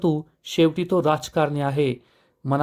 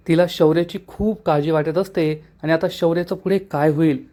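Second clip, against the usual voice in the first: usual speaking voice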